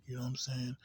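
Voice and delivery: normal talking voice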